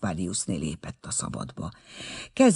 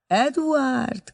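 mocking; 12 semitones higher than usual. A narrator's reading. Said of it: "kérdezte a lány gúnyosan".

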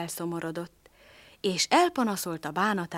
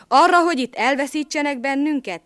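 urgent; 6 semitones higher, 8 dB louder than usual. Acted. Dog urges escape.

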